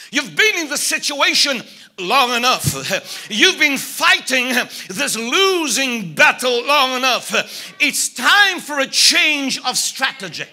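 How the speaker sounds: ordinary talk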